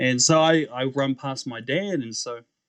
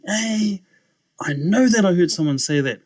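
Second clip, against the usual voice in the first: raised, higher voice, baffled; startled insistence; 6 semitones higher, 5 dB louder than usual. Retold or imitated. Alone behind hospital curtains, baffled and insistent. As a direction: insist on it, louder as you go.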